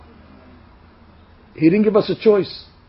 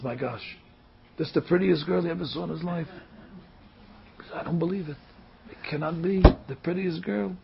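hushed amazement; 12 dB quieter than usual. Acted. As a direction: marvel under your breath. Groom stunned by bride's beauty.

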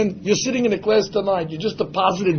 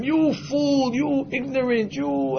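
higher, softer drawn-out taunt; mock jeering; 6 semitones higher, 5 dB quieter, slower than usual. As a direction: imitate neighbors laughing at a fool. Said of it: "they're laughing at him".

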